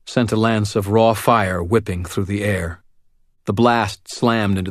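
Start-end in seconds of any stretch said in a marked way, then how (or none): none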